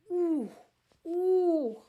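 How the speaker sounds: loud, high swooning ooh. Real, not acted. Gushing about Texan men, a loud swooning ooh.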